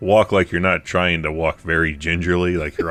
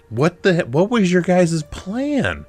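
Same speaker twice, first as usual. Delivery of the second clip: incredulous; 11 semitones higher, slower than usual. Retold or imitated. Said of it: "I'm like".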